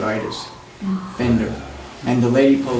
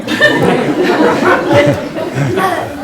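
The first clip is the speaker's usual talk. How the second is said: high laugh breaking out; 14 semitones higher, 7 dB louder than usual. Real, not acted. Remembering Ida bossing him, high laugh. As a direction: laugh at the memory. Laughing after Ida's command.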